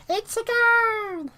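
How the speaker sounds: very high, slow, drawn-out puppet cheer; high stretched Elmo cheer. Retold or imitated. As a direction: squeal the goal like Elmo. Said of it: "when I did an Elmo impression".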